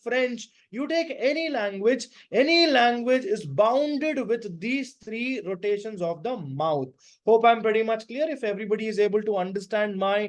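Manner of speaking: ordinary delivery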